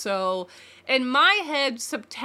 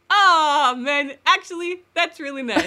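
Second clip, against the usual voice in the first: relieved aw; 4 semitones higher, 5 dB louder than usual. Retold or imitated. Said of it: "I was like".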